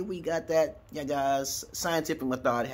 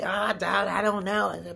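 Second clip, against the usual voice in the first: scared whiny voice; 9 semitones higher, slower than usual. Retold or imitated. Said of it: "people out there who just say".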